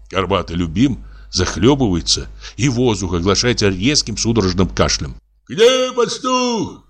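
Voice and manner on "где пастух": very high, drawn-out call; distant cry. A narrator's reading. Someone on the bank looking for the shepherd, calling out. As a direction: holler it long and high from the bank. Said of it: "слышится с берега крик".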